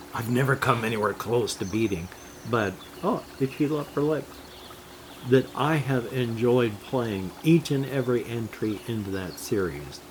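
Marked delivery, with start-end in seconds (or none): none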